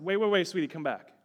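mildly urgent retold call; urgent calling back. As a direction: stop the child before they leave. Calling daughter back as she leaves.

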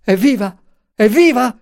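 frantic, high-pitched shout; desperate cry. A narrator's reading. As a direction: cry out urgently to them. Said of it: "Gridò appena li vide".

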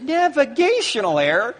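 high, loud mocking disbelief; incredulous outburst. Viewer yelling back at the TV commentator, high incredulous mockery. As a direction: build from a baffled question to a ranting shout.

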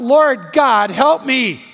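hammed-up desperate shout; acted scream. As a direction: cry out for rescue. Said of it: "he screams out".

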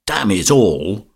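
slow, drawn-out burst of irritation; irritated outburst. A narrator's reading. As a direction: curse in exasperation, stretching each word. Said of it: "in a moment of ungovernable irritation he said".

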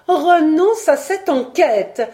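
high, loud, insistent entreaty; pleading cry. A narrator's reading. Young woman begs her lover to drop the investigation, pleading cry. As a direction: cry it out, stretching the words. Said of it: "s'écriait Simone".

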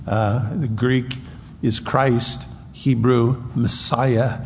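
usual speaking voice

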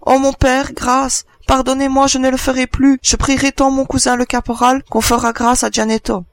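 put-on high child's voice begging for mercy; desperate pleading. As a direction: implore father, pitch held high throughout. (supplication désespérée d'enfant)

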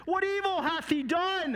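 high, impassioned challenge; Pilate. Acted. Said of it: "And Pilate said".

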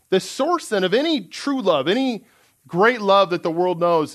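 ordinary delivery

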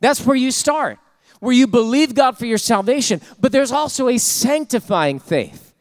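high, loud fervent preaching, rising; full-force preaching. Acted. Pastor preaching fervently to his congregation, loud and high. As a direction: preach it hard to the church.

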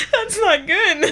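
laughing through the fake anger; mock rant, laughing. Cracking up mid-rant in a bad impression, laughing. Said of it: "That's not a good impression".

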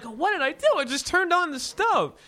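high, pleading mock alarm; high-pitched flustered disbelief; retold panicked protest. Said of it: "I'm like".

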